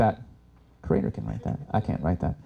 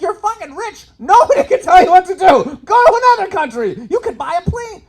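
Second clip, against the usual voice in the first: much higher and louder, half shouting; exasperated urging; 8 dB louder than usual. Retold or imitated. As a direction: shout him some sense. Urging a rich friend.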